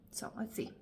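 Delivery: soft unvoiced whisper; hushed whisper before smelling. Real, not acted. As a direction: whisper it as you lean in to sniff.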